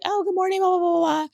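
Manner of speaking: high singsong chatter; mock morning greeting. Woman retelling the morning after to viewers, high mock greeting. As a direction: skim through it in a high, put-on voice.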